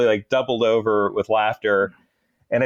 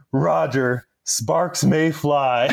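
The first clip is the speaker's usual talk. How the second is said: slow, deliberate deadpan nerd imitation; Napoleon Dynamite; much slower than usual. Driver answering his officer mid-firefight, mimicked slow nerd deadpan. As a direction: stretch it out like a movie nerd's catchphrase. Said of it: "the Napoleon Dynamite".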